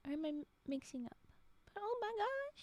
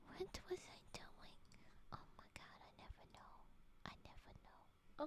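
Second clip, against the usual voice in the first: hushed whisper to herself; whisper, forgetting what she was doing; lost whisper; 3 semitones higher, 16 dB quieter than usual. Real, not acted. Streamer loses track, whispering to herself.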